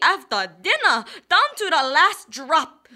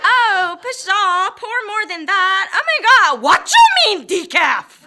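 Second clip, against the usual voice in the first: outraged customer; 5 semitones higher, 7 dB louder than usual. Acted. Snooty customer outraged at decaf.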